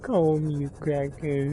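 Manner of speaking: ordinary talk, nothing marked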